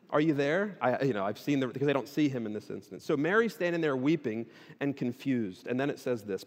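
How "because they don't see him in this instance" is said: usual speaking voice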